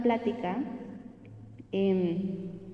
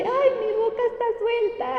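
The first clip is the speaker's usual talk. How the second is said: high, crying voice breaking with relief; sobbing with joy; tearful relief; 12 semitones higher, 3 dB louder than usual. Retold or imitated. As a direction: weep the words out, much higher. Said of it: "me suelto a llorar".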